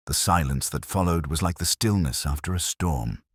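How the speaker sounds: ordinary delivery, nothing marked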